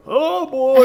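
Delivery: high, loud mock dread; exaggerated oh boy. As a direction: blurt it out like bracing for trouble. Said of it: "I'm like".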